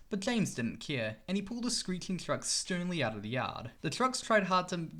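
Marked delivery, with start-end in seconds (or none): none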